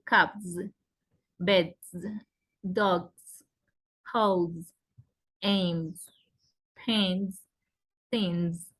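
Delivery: each word ends on a buzzed z; plural ending as Z. Shown as a demonstration. Teacher showing plurals after voiced sounds, buzzed z endings. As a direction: voice the final s of "cabs", "beds", "dogs" through "things" as z, word by word. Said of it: "suena Z".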